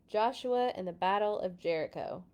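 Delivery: ordinary delivery, nothing marked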